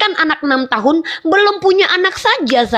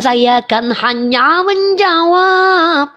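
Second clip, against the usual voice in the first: slow innocent protest; 3 semitones higher, much slower than usual. Real, not acted. Defends self to angry host.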